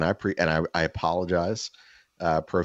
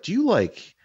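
higher, curious questioning voice; a light higher neighbor voice; 12 semitones higher than usual. Retold or imitated. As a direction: play the neighbor probing, pitched up. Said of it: "And he's just like".

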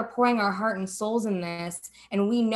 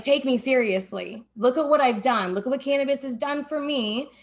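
rising, louder, insisting to be taken seriously; insistent plea; 5 semitones higher, 5 dB louder than usual. Retold or imitated. Businesswoman pleading with doubting men, insistent and raised. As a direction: push each line higher, demanding respect.

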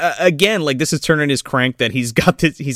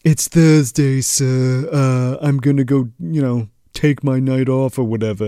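mock butler. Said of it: "gives his butler the night off".

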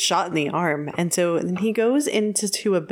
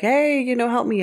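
calling out; 6 semitones higher than usual. Retold or imitated. Bill calling to the soldiers.

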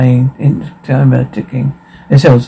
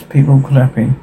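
usual speaking voice

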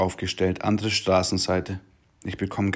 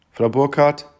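Polizistin fragt; 5 semitones higher, 5 dB louder than usual. Retold or imitated. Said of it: "fragt die Polizistin".

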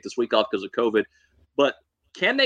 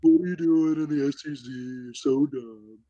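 mocking taunt. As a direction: drag out the insult, half mocking. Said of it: "when they talk about A and M".

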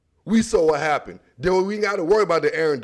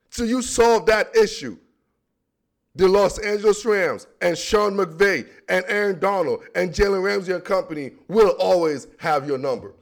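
normal talking voice

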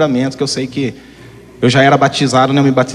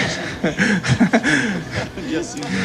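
real laugh, higher than speaking voice; amused laugh; 6 semitones higher, 4 dB quieter than usual. Real, not acted. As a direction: laugh at your own absurd confession. Laughs at his own fasting joke. (risada divertida)

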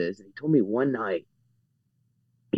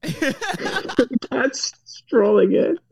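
laughing through it; much slower than usual. Real, not acted. Cracking up mid-story.

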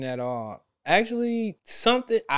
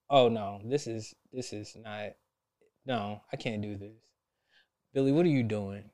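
dismayed mutter; 6 semitones lower, 6 dB quieter than usual. Retold or imitated. His own inner alarm.

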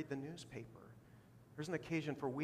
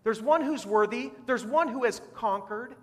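much higher and louder, urgent and excited; elder calling out; 9 semitones higher, 12 dB louder than usual. Retold or imitated. Elder bursting in to stop John weeping, loud and urgent. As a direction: cry it out like good news that can't wait. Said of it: "He's saying".

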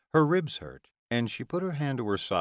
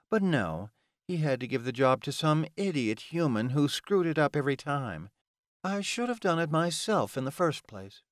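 annoyed, higher-pitched villainess rant; 5 semitones higher than usual. Acted. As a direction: vent exasperation at the incompetent helper. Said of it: "she ranted".

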